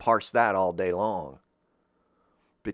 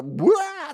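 high shrieking yelp; a sudden high cry of shock; startled yelp; 7 dB louder than usual. Retold or imitated. Detectives find the rolling head, high startled yelp. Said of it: "and they go".

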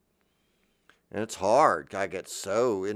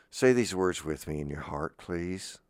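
normal talking voice

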